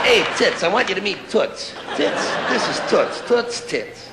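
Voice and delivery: cheery, laughing host; mock introduction. Comic playing a host introducing two pals, cheerful. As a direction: introduce two friends at a party.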